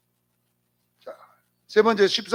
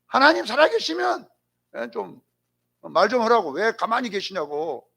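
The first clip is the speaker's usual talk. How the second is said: acted exasperation, demanding a reply; arguing with God; 4 semitones higher than usual. Job demands that silent God speak, indignant. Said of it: "따지고 있는 거예요".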